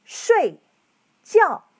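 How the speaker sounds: 睡 and 觉 each falling sharply from high; 睡觉 said as shuìjiào, both tones dropping hard; fourth tones. Shown as a demonstration. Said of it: "Both words are fourth tones".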